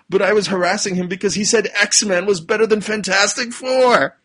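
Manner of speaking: high, loud indignant mock bully; bully's silly excuse. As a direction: blurt the petty excuse with hammed-up outrage. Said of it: "And the bully says".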